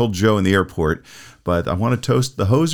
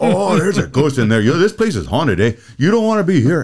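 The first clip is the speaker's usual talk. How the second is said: hammed-up Canadian accent, higher and louder; Canadian hoser voice; 6 semitones higher, 4 dB louder than usual. Acting out the hosers' ghost bit, a higher, louder Canadian accent. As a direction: play the stoned Canadians spooking each other in a Canadian accent. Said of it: "that sounds so like a comedy skit".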